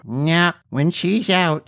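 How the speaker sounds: unbothered little gnome; gnome's voice. A narrator's reading.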